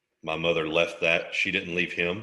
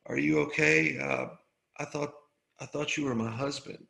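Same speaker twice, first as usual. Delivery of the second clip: half-asleep woman; 6 semitones higher than usual. Retold or imitated. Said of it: "and she says".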